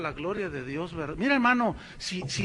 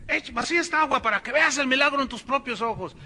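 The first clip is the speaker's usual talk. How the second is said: higher, louder stage order; showman command; 7 semitones higher, 4 dB louder, faster than usual. Retold or imitated. Preacher tells the helper to pour the water, higher and louder. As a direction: sell the miracle.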